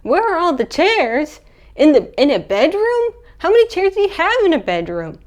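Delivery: high, loud, exasperated disbelief; incredulous. Real, not acted. Teller questioning the film's chairs line, incredulous. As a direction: push the questions way up, baffled.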